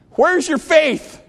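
high, loud, slow where's your faith; Jesus crying out to the disciples; preached cry. Acted. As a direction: throw it out to the whole church. Jesus rebukes disciples, preaching climax.

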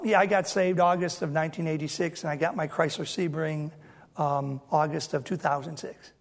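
flat, low and soft, same breath; deadpan unexcited soft aside; no excitement. Shown as a demonstration. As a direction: say it all in one dull tone. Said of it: "in the same breath with no excitement".

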